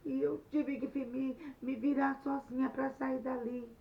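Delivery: loud, high accusing cry; crying shout. Acted. Anete accusing Luiz to the teacher, loud tearful shout. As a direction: wail out the blame on him. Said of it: "começou a chorar e gritou assim".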